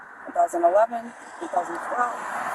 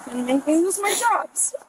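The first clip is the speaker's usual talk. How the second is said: real emotional fear; 8 semitones higher than usual. Real, not acted. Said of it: "now I'm getting more emotional".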